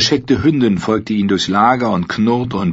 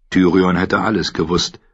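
ordinary delivery, nothing marked

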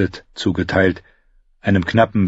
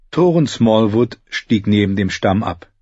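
usual speaking voice

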